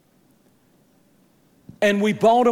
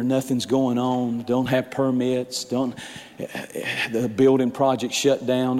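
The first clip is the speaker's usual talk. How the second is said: lightly voiced low, discouraged builder; grumbling Zerubbabel; 8 semitones lower than usual. Builder grumbles to prophet, low and discouraged. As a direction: mutter the complaints like a weary builder.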